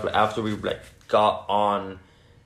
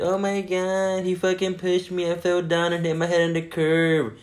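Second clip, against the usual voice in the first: mock crying; 7 semitones higher than usual. Crying friend tells them he pushed her, mock crying. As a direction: imitate a tearful friend, pitched up. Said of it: "starts crying like".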